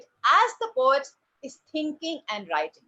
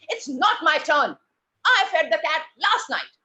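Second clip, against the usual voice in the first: angry; 3 semitones higher, 5 dB louder than usual. Shown as a demonstration. Angry child telling off a younger sibling. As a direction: hit each word hard, crossly. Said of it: "And now you are angry".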